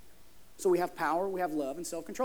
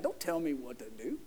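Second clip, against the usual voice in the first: very low, hushed joking voice; mock stubborn guy; 7 semitones lower, 8 dB quieter than usual. Preacher repeating his inside joke, mock stubborn and low. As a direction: sink right down low for a jokey refusal.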